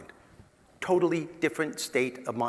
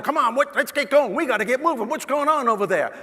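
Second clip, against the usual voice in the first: impatient drivers; 10 semitones higher, 6 dB louder than usual. Retold or imitated. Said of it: "they're going".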